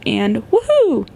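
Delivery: ordinary talk, nothing marked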